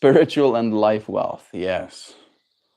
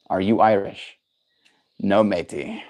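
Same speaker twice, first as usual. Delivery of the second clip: a lightly put-on, hammed-up Irish accent; mock Irish pirate-ish twang, barely there; half-hearted Irish brogue; bad Irish accent; 3 semitones lower than usual. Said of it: "my horrible attempt at an accent".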